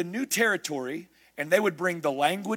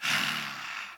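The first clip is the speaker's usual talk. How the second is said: contented sigh; 5 dB quieter than usual. Retold or imitated. Mocking a satisfied churchgoer.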